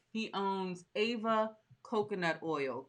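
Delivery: ordinary talk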